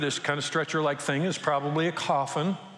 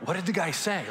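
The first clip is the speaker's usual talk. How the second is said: wondering; 3 semitones higher than usual. Retold or imitated. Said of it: "But I'm like".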